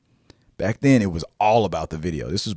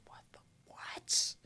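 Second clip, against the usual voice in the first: whispered disbelief; 14 dB quieter, slower than usual. Retold or imitated. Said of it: "I was like".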